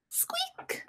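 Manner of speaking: tiny high unvoiced squeak. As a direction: squeak like a little mouse. Squeaky noise in story.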